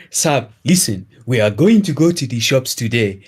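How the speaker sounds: lightly put-on Nigerian accent; a hint of a Nigerian accent, friendly. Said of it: "thick Nigerian accent".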